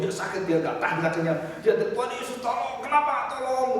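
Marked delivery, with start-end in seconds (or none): 1.8-3.8 high, imitated wail of a sick pastor; mock pleading cry. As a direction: mimic his desperate plea, high. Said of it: "dia teriak sampai seluruh rumah sakit". (meniru jeritan memohon, tinggi)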